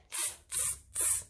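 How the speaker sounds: whispered teasing tss of monkeys. Acted. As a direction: hiss back like a cheeky monkey. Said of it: "the monkeys ... said".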